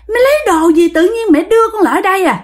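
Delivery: high, whiny possessed husband; pleading like a woman trying to act tough; high wheedling womanly voice forced through a man. Retold or imitated. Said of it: "cái kiểu ổng giống như đàn bà vậy đó".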